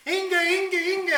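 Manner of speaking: high, loud panicked calls of a name; screaming for help. Acted. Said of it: "runs out of the cornfield screaming".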